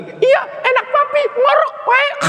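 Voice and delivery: squealing high mock shout at her husband; rude wife. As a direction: screech at your own husband, way up high. Istri membentak suami sendiri. (istri membentak suami dengan pekikan tinggi)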